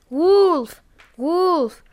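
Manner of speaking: high, loud rehearsed yell of Wolf; mock wolf cry. Shepherd boy rehearsing his trick aloud, a playful shouted cry. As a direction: try out a fake alarm call. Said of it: "I will call".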